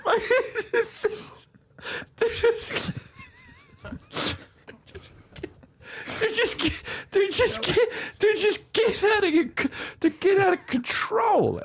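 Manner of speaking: high squeaky voice through laughter; helpless laughter. Real, not acted. Comedian retelling agent's words, breaking up in high-pitched laughter. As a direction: struggle to get the words out while cracking up.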